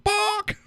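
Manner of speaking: very high yelped bark; tiny high dog bark; mock bark. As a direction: yap it out like a little dog.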